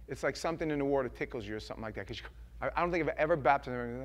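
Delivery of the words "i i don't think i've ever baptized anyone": ordinary delivery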